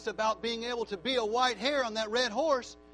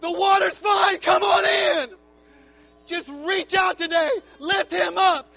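loud, high crying out to the Lord; fervent shouted prayer; 7 semitones higher, 11 dB louder than usual. Real, not acted. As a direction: pour out worship at full voice. Leads shouted worship prayer.

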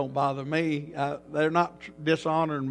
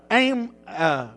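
ordinary talk

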